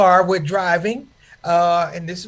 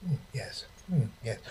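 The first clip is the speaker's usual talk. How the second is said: low, quiet mock mafia boss; Shia Godfather; 10 semitones lower, 12 dB quieter than usual. Comic imitates the imam as a solemn godfather. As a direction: murmur it like a grave godfather. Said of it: "he was like the Shia Godfather".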